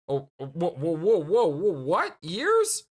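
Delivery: rising shocked whoa; surprised. Real, not acted. Shocked reaction to "years.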